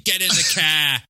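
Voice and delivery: shouted New York "get in the car"; barking like a New York cop; New York accent. Retold or imitated. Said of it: "basically a New York accent".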